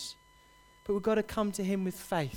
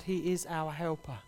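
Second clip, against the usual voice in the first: slow and soft; 3 semitones lower, 6 dB quieter than usual. Shown as a demonstration. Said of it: "repeated line, slowed".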